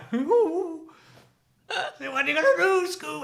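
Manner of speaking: very high, loud cartoon voice with a laugh; high-pitched goofy sidekick, loud; Shaggy from Scooby-Doo. Podcaster proud of his impression, Shaggy voice. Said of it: "I think my, my Shaggy thing's pretty good".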